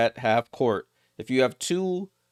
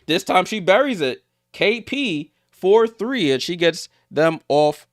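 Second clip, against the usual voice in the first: higher-pitched play-by-play excitement; excited call; 5 semitones higher, 3 dB louder than usual. Real, not acted. Commentator calls a buried three-pointer, excited. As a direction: call the basket with rising excitement.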